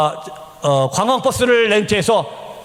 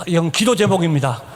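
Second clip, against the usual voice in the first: normal talking voice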